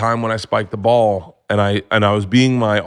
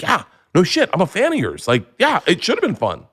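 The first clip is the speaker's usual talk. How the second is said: high-pitched, emphatic comeback; mock exasperated fan; 8 semitones higher than usual. Podcaster retells his reply to a guest, mock exasperated.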